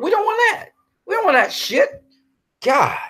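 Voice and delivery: real fury at the studio; angry outburst. Real, not acted. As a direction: pitch shooting way up in exasperation. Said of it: "I am just very angry".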